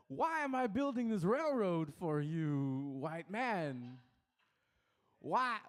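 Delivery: a slow Walken voice playing a railroad worker. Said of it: "Christopher Walken with W O K".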